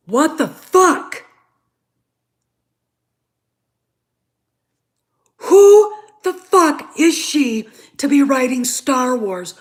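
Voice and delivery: slow, loud disbelief, pitch up; outraged. Real, not acted. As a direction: spit it out, furious and drawn out. Ranting about a Star Wars writer.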